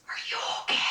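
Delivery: soft unvoiced whisper, barely breathing. Wife whispering to husband after surviving the bridge, whispered. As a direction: hush it out as if afraid to break the silence. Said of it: "in a whisper".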